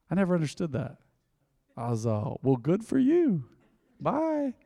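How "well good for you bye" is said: mock congratulation. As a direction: act out a sarcastic brush-off, pitched up high. Acted dismissive reply in anecdote.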